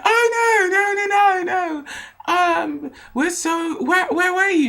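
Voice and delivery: put-on high, loud worried girlfriends; frantic friends. Friends rushing up to the lost girl, high frantic voice. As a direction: gush like panicked drunk friends.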